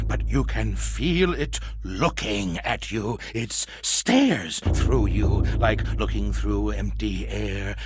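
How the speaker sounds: ordinary talk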